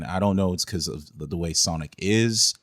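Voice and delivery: ordinary talk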